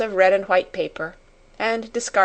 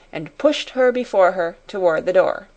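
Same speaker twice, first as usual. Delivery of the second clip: plain reading voice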